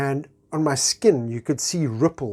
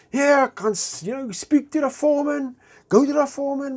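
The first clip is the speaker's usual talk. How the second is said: mock builder voice; 11 semitones higher than usual. Builders answer him.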